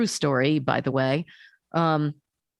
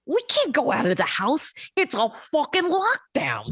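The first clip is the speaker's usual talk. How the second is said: put-on indignant little kid; 8 semitones higher than usual. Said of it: "he goes".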